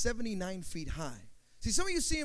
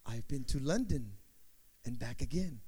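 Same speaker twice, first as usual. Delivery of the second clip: sing-song nursery rhyme; 4 semitones lower than usual. Retold or imitated. Father's nursery rhyme, recited in two voices. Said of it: "nursery rhyme that my father used to always share".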